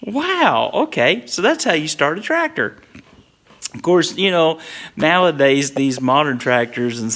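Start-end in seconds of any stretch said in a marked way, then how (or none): 0.0-2.8 surprised realization. Retold or imitated. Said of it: "I was like".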